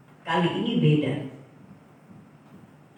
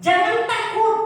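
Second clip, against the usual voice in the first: "Jangan takut" cried out much higher and louder; preached shout; 13 semitones higher, 4 dB louder than usual. Real, not acted. Preacher cries "Jangan takut" to the congregation, high and loud. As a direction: proclaim "Jangan takut" at the peak.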